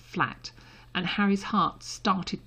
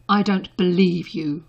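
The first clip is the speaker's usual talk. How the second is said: slow, louder, fervent disbelief; 7 dB louder than usual. A narrator's reading. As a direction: lean hard into the disbelief. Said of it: "he said fervently".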